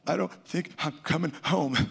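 high, choked voice through tears; a lightly replayed sob on the phone to his wife; retelling a tearful call to his wife, voice breaking high; weeping. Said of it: "And I started weeping".

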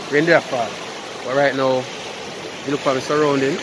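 usual speaking voice

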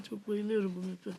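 ordinary talk

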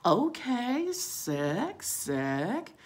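high 'okay', rising drawn-out 'sick'; impressed approval. Retold or imitated. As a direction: react coolly impressed, each word swooping up. Her acted impressed reaction.

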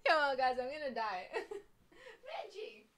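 high, laughing till it sounds like crying; helpless laughter. Real, not acted. Host loses it after her own bit, real helpless laughter.

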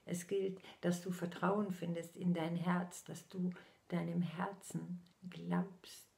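normal talking voice

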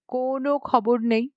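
ordinary delivery, nothing marked

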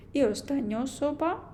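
usual speaking voice

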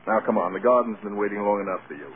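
normal talking voice

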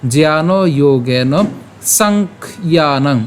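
"জ্ঞান যোগেন সাংখ্যানং" slow, সাংখ্যানং split after sankh; pronunciation demo. Shown as a demonstration. Teacher shows the joined sounds, slow demo of "জ্ঞান যোগেন সাংখ্যানং". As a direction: draw out "জ্ঞান যোগেন সাংখ্যানং", breaking the last word as sankh-yanang. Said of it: "তাহলে তার উচ্চারণটা কেমন হবে".

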